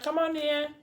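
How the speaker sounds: a hint of a sweet, high host's welcome; welcoming mother. Mother inviting her son's girlfriend inside, high and sweet.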